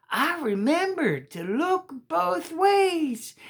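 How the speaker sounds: put-on high, slow child's voice; proud little boy. Walker proudly tells his mama, high and slow.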